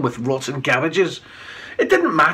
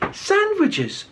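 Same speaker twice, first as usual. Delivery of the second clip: bright, much higher cheery reply; cheerily. A narrator's reading. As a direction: sing it out cheerfully. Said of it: "Thorfinn replied cheerily".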